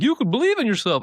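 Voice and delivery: high-pitched parody of a motivational slogan; mock buzzword pep. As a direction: chirp it like an empty pep talk. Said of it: "just be like, you know, buzzwording it up".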